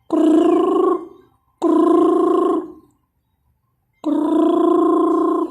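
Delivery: high, loud rolled krrr like a bird; imitated bird trill. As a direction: trill at the bird to call it back. Imitating bird call to lure it.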